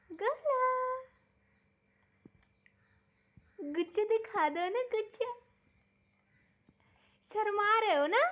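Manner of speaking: high, loud coaxing sing-song; baby talk. Acted. Mother coaxing Bulla to make an angry face, high baby talk. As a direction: egg the little one on, playful and bright.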